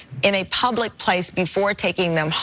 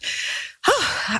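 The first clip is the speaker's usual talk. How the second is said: long airy out-of-breath sigh; breathy sigh; 10 semitones higher than usual. Real, not acted. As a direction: let out a winded sigh. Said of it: "as I catch my breath".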